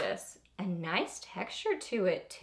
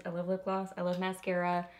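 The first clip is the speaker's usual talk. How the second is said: usual speaking voice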